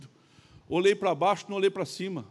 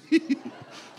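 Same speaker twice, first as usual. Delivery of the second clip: high breath of real laughter; 10 semitones higher than usual. Real, not acted. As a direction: laugh mid-story at the absurdity. Laughing at his own anecdote. (risada aguda)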